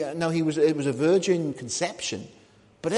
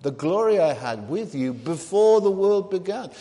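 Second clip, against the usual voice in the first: raised, loud prayer quote; Jesus; 5 semitones higher, 5 dB louder than usual. Acted. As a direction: declare the prayer with lifted pitch and volume. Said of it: "somebody praying like that".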